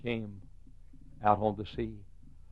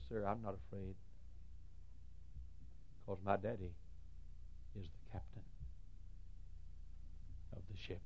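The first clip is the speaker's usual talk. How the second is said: put-on calm little girl; 2 semitones lower, 18 dB quieter than usual. Calm child answers in story.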